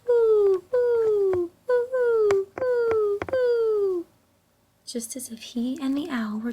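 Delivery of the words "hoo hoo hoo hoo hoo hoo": much louder, high ringing owl hoots; Pa hooting back to the owl, loud and ringing; loud owl call back. Acted. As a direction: call back to the owl as if talking about supper. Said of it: "Then he called back".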